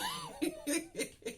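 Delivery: high-pitched burst of giggles. Real, not acted. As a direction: giggle at your own story. Laughs at her own story.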